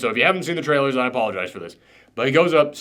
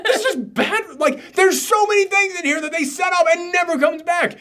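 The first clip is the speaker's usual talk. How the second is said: much higher, fed-up outburst; exasperated shout; 12 semitones higher than usual. Real, not acted. Reviewer venting at the film's setups, fed-up high shout. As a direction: throw up your hands at the plot holes. Heated rant about the movie.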